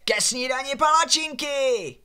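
high, drawn-out call to breakfast; shouting. A narrator's reading. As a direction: yell it out across the yard. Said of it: "Pavel vylítl ven a křičel".

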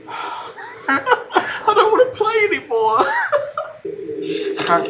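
high, slow whine through laughter; laughing whine. Real, not acted. Scared player gives up the game, laughing. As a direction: give up, laughing.

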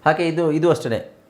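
ordinary delivery

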